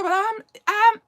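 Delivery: high, loud, halting then crying out; stumble, then burst out as if overcome; overwhelmed, lost for words. Retold or imitated. Manager too thrilled to talk about her healing, overwhelmed outburst. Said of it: "She just couldn't even talk".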